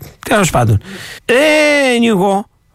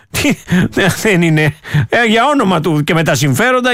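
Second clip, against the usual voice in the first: ordinary talk, nothing marked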